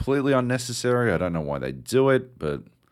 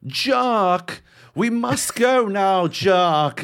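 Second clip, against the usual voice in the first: put-on much higher, slow, accent sliding between Boston, Liverpool and South African; a caricature of a badly acted video game companion; mock wobbly game accent; 11 semitones higher, 5 dB louder, much slower than usual. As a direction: ham up a voice actor whose accent keeps drifting. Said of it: "Her accent is so fucking all over the place".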